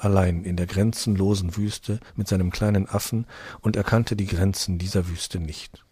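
ordinary delivery

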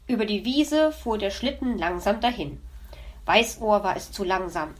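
ordinary delivery

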